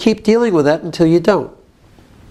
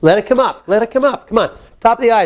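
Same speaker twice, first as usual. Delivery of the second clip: ordinary talk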